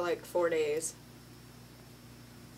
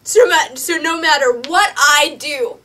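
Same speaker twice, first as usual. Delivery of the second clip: loud, higher, mocking sarcasm; sarcastic shout; 7 semitones higher, 11 dB louder than usual. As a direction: yell the sarcasm in his face. [name] mocks self-acceptance, shouting.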